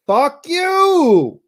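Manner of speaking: angry curse. Real, not acted. Streamer cursing the app that hid his tools, furious. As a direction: fling it at the screen.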